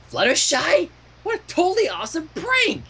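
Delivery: high, gleeful crow. A narrator's reading. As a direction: crow it out, delighted by the prank. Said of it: "she crowed".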